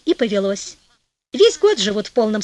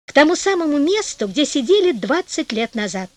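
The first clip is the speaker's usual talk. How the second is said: ordinary delivery, nothing marked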